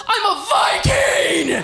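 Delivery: high, drawn-out battle cry; triumphant yell. Acted. Stoned woman announces herself to the party, triumphant yell. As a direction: yell it slowly to the whole room. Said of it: "and then I just yelled".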